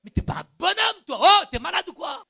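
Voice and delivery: loud, rising self-rebuke; shouting down his own pride; scolding shout. Retold or imitated. Il y avait un combat.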